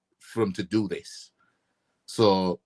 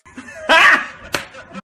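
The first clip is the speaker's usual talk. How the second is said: loud laugh; 9 dB louder than usual. Real, not acted. Laughing at own parody.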